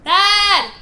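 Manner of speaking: loud call. Real, not acted. Calling for dad in another room, loud shout. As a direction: call out sharply for a parent. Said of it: "that was really loud".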